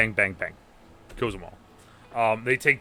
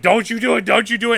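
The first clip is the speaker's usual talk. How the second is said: high, urgent begging; pleading mother; 10 semitones higher, 4 dB louder, faster than usual. Retold or imitated. Mom begs son not to kill dad, high and urgent. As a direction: beg him to stop, voice up high.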